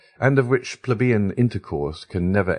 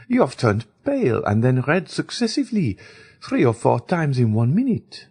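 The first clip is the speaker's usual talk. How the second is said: Baroness speaking; 7 semitones higher than usual. A narrator's reading. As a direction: fuss over the pale girl gently. Baroness voiced in the story.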